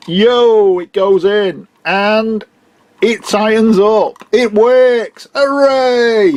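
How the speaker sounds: high, delighted and drawn out; excited. Real, not acted. The fix works, he cheers.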